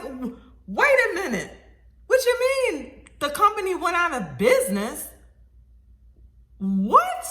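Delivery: high, loud disbelief; incredulous retold protest; going crazy on the phone. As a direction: demand an explanation, pitch leaping up. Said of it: "I was a little crazy on the phone".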